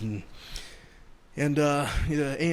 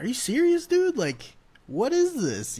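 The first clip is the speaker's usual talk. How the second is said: high, louder disbelieving retort; retold disbelief, pitched way up, stretched at the end; incredulous; 12 semitones higher, 4 dB louder, slower than usual. Teller replays reaction to a curfew warning, incredulous.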